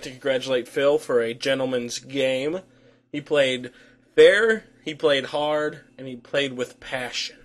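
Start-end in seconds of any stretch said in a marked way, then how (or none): 4.1-4.6 fair punched high and loud; stress on fair. Acted. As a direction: hit the word fair hard. Emphatic praise list.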